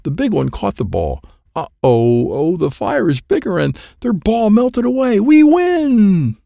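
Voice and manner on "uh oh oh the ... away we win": alarm rising into a high, loud cheer; building excitement. Acted. Kid sees the other team's ball melt and wins, thrilled. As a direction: build from uh-oh to a drawn-out victory cry.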